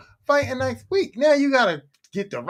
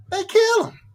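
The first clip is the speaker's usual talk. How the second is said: indignant; 11 semitones higher than usual. Real, not acted. Indignant high repeat.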